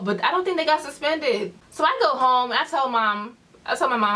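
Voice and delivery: normal talking voice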